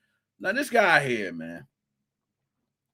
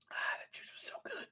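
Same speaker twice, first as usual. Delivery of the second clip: whispered aside; 21 dB quieter, faster than usual. Real, not acted. Sipping juice aside.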